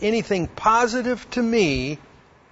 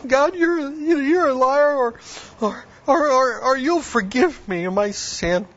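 high, breaking voice, half sobbing; sobbing prayer; 7 semitones higher than usual. Retold or imitated. New believer sobbing his first prayer to God. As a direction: pray through the tears, voice cracking high. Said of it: "and I'm kinda sobbing".

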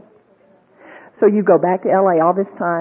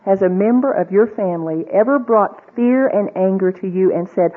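ordinary talk, nothing marked

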